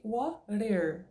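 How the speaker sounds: WATER with the T tapped like a Portuguese R; slow WATER, T turned into a light R tap. Shown as a demonstration. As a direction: flap the T in WATER. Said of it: "como se fosse o nosso R".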